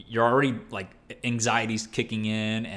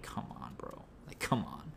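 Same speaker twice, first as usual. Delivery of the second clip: anxious whisper; 7 semitones lower, 12 dB quieter than usual. Retold or imitated. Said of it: "We're like".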